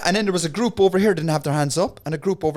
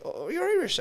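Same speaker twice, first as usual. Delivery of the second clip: mock surprised high voice; 13 semitones higher, 6 dB quieter than usual. Comedian voicing Jim Owen in story.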